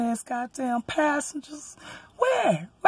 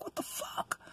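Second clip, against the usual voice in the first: muttered disbelief; 16 dB quieter, slower than usual. Retold or imitated.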